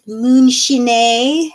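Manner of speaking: a posh joke take on "moonshine" said as "moon-shin-ay"; fancy pronunciation. Shown as a demonstration. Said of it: "how can we pronounce moonshine fancy".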